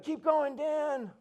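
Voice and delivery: high, loud cheer from the roadside; cheering spectator. Retold or imitated. Spectator cheers the runner by name, loud and high. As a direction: shout encouragement at a passing runner. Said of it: "they're like".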